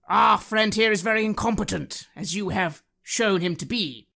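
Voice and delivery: loud, grand in-character address; pompous declaration. Acted. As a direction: puff up and lecture the dragon. Player speaks in character to dragon.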